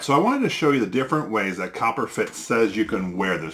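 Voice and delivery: ordinary talk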